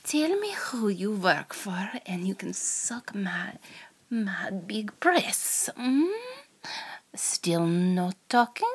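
whispered Russian spy interrogator; hammed-up Russian accent, hushed start; over-the-top Russian accent. Interrogator taunting the captured American spy in a Russian accent. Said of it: "in a Russian voice".

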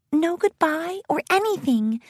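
high, small shy pony voice; light, timid and high; timid Fluttershy. A narrator's reading. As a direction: add it meekly, in a high voice. Shy pony's line in story.

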